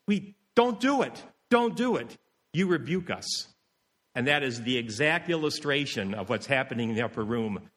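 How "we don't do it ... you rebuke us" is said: high-pitched acted pleading; scandalized protest. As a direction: protest in a raised pitch, imitating upset church members. Church members protest to their pastor.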